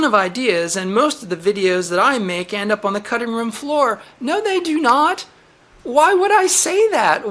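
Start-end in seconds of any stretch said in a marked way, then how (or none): 4.2-7.3 indignant denial. Real, not acted. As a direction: push back hard, offended. Denies YouTube's fake claim.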